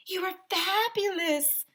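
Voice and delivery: high, slow, drawn-out gush; gushing photographer. A narrator's reading. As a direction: purr it like a diva. Miss Joni gushing.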